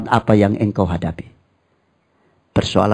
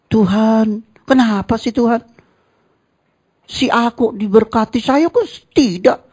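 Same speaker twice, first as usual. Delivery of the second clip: much higher, whiny mock sulk; sulking churchgoer; 10 semitones higher than usual. Sulky believer complains to God about others' blessings. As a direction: whine like a jealous sulker.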